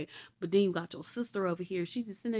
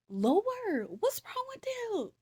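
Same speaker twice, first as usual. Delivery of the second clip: high, pleading cry to the Lord; exasperated prayer; much slower than usual. Retold or imitated. Narrator praying to the Lord about the crowd, high exasperated plea. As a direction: ask the Lord in mock despair.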